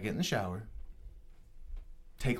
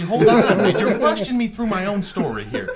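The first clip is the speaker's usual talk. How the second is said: laughing protest; 7 semitones higher, 8 dB louder than usual. Real, not acted. Protesting friend rushing him.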